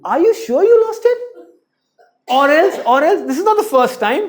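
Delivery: high, loud accusing parent; interrogating parent. Retold or imitated. Parent accusing a child over a lost pencil, loud and shrill. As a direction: grill the kid like a suspect.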